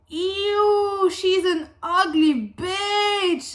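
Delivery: high, loud, slow sneer of disgust; mocking partygoer. Imagined partygoer jeering at her: mock sneering disgust. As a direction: drag out the insult like a cruel mean girl.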